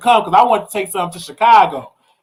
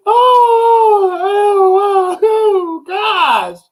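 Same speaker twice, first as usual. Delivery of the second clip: laughing fit; 10 semitones higher, much slower than usual. Real, not acted. Cracking up at the chat, high laughing cries. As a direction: crack up and gasp it out.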